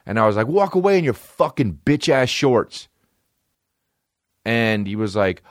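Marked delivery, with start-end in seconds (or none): 0.5-2.9 high, re-enacted road-rage jeer; retold mad yelling. Driver mocking the departing pedestrian's shorts, retold angry yell. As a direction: taunt him in a high mock yell. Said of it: "And I'm mad".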